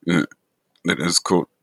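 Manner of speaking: ordinary talk, nothing marked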